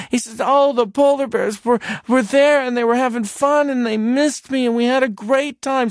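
put-on high, loud little boy gushing; excited child. Son telling his father about the polar bears, high and gushing. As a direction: tumble the words out like a thrilled kid.

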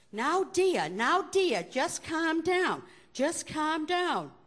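put-on nun's Boston accent, high and slow. Flustered nun calming the father in a Boston accent. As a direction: soothe him in a drawn-out Boston accent, dropping the r in "dear". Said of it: "That's the Boston accent".

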